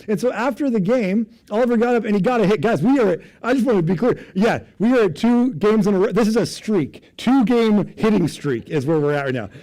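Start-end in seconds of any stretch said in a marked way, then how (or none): none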